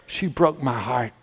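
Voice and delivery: low, soft, wounded admission; heartbroken. Acted. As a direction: collapse from fury into quiet hurt. Joseph's anger collapses into hurt.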